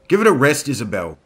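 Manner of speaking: loud irritated snap; snapping. Acted. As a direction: cut her off sharply. Marco snaps at Isabel.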